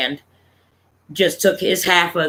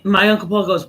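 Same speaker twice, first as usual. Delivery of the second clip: usual speaking voice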